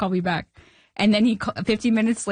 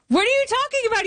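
incredulous outburst; faster than usual. Retold or imitated. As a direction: act out a stunned, high-pitched protest. Retelling her disbelief to the agents.

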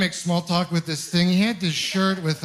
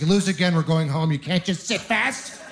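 scolding mother. Retold or imitated. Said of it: "She's like".

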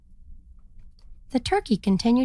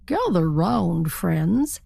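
slow proud call; 2 semitones lower, much slower than usual. Acted. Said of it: "he said to the other turkeys".

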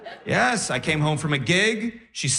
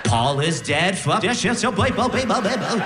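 hidden-message gibberish, rising higher; backwards record; 5 semitones higher than usual. Retold or imitated. Comic parodies a record played backwards, garbled babble. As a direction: slide from words into reversed-vinyl babble. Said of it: "turning a record backwards".

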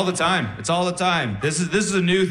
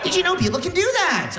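high, incredulous question. Acted. Comic telling crowd of wife's sleep talking, incredulous. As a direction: ask it in high-pitched disbelief.

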